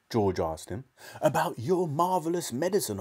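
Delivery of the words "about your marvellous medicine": high-pitched eager father; excited. A narrator's reading. Father answers his son about the medicine, excited and high. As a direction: lift the pitch with enthusiasm. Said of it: "in a state of greater excitement".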